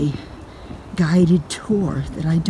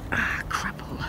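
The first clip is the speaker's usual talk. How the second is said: whisper; 14 dB quieter, slower than usual. Real, not acted. Muttered curse to herself.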